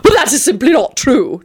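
high, indignant denial; prim, affronted objection; offended. A narrator's reading. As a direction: protest with wounded dignity. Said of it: "replied in an offended voice".

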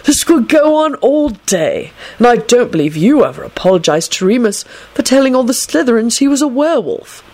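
stern. A narrator's reading. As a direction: chide at length, pitched high. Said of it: "Dumbledore told him sternly".

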